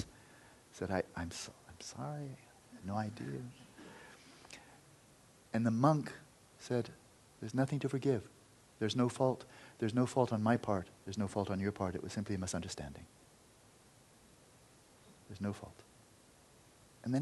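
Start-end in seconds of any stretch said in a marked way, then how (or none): 0.9-3.4 remorseful apology. Acted. 7.4-15.8 gentle forgiveness. Acted. Dying monk forgives gently.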